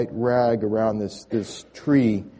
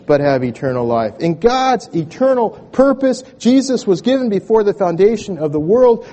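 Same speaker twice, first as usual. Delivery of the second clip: higher and louder, rising to a passionate call; full-force preaching; 9 semitones higher, 9 dB louder than usual. Acted. Pastor urging the congregation to respond, preaching loudly. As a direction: preach it out to the whole room.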